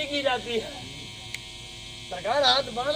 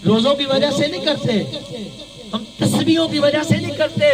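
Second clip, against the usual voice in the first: loud, raised-pitch sermon delivery; impassioned preaching; 4 semitones higher, 6 dB louder than usual. Real, not acted. Preacher to congregation on why we love sayyids, impassioned. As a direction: preach it out at full force.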